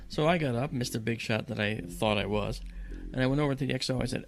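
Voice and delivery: ordinary talk, nothing marked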